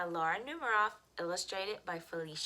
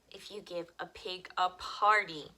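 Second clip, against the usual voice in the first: usual speaking voice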